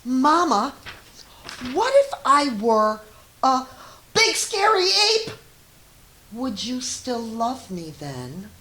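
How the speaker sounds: put-on high, loud little-child voice, eager and wondering; pleading child. A child in bed asking Mama a big question, high and eager. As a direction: squeak it up as an excited small child.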